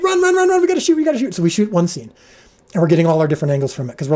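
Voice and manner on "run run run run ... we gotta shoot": much higher, louder, breathless with laughter; mock frantic rush, laughing; laughing panic. The crew rushing to the cleared lake, laughing frantic call.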